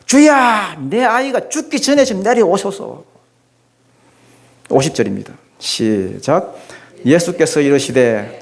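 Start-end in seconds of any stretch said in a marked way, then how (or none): 0.1-3.1 높은 목소리로 애원하듯 "주야"; 똥줄 타는 아버지처럼 매달리며; 애타는 간청. Acted. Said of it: "신하는 지금 막 똥줄이 타는데". (아이 살려달라 예수께 애원하는 신하, 높게 애원하듯)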